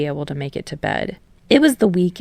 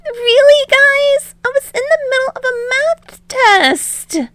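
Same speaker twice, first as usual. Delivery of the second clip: high, loud whiny exasperation; fed up with being snatched again; exasperated; 6 dB louder than usual. Acted. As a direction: Izuku wailing at the league, loud and high. Kidnapped again mid test.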